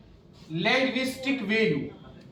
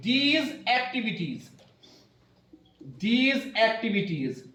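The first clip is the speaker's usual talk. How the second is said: slow, word-by-word dictation pace; much slower than usual. Acted. Teacher dictating notes to her class, slow and deliberate. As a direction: spell it out slowly for students to write.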